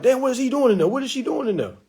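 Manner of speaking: a sudden yelp at the belly; startled mom. Retold or imitated.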